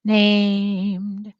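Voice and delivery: "Named after King" drawn out slowly word by word; dictation pace. Shown as a demonstration. Teacher dictating as students write, word by word. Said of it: "Say it as you're writing it down".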